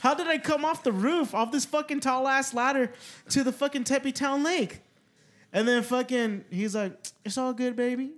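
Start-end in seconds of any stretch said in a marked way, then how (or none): 0.0-4.8 much higher, louder disbelief; half-laughing, can't believe it; retold drunk confusion, pitched up; incredulous. 7.2-8.1 higher mock soothing coo; a light take on a friend's calm; hammed-up easygoing buddy; imitating Marcos. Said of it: "Like how he talks".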